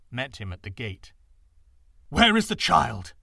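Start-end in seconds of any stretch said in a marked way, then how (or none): 2.1-3.1 loud, breathless demand; panted demand. A narrator's reading. Exhausted father demands his child from his wife, loud panting. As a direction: gasp it out after the long journey. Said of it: "he panted at her".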